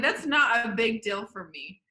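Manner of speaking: normal talking voice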